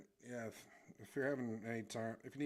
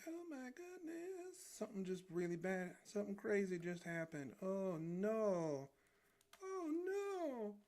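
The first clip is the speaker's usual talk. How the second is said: much higher, panicky falsetto squeak; panicked cartoon guy; 12 semitones higher, 4 dB louder than usual. Drawing streamer voicing his cartoon guy, high panicky falsetto. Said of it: "This guy just going like".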